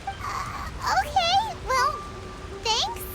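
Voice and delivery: high, hesitant, halting acceptance; reluctant thanks. Acted. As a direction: accept it awkwardly in a high-pitched voice. Reluctantly accepting the fishy gift.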